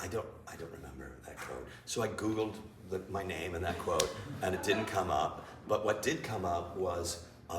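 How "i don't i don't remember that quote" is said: low, quick, quiet disbelief; puzzled mutter. Retold or imitated. To himself about a quote he never said, a quick quiet mutter. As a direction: mumble it under your breath, baffled. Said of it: "And I'm like".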